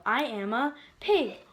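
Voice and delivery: ordinary delivery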